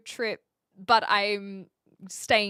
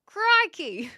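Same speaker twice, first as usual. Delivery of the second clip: high, loud Aussie exclamation; badly faked Aussie accent, higher and louder; Steve Irwin; 4 semitones higher, 4 dB louder, much slower than usual. Shown as a demonstration. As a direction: attempt an Aussie catchphrase with a punch. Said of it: "Um, Steve Irwin".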